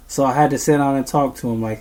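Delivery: ordinary delivery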